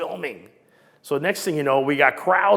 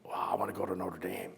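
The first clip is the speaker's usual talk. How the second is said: awed whisper; 5 semitones lower, 10 dB quieter than usual. Retold or imitated. Kid's awed wish, retold.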